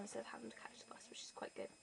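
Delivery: whispered aside. Real, not acted. Whispering to viewers about Mum driving her. Aside while gerbils rattle.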